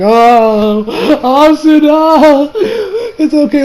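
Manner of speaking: high, wailing fanboy sympathy; hammed-up sobbing reassurance; mock-tearful comforting. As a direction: whimper it out as if about to cry. Acts out own tearful reaction.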